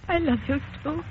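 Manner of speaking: ordinary talk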